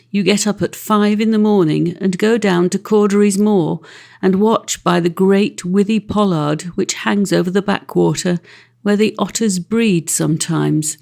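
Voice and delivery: ordinary delivery, nothing marked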